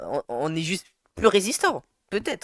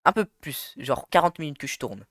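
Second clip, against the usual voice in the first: usual speaking voice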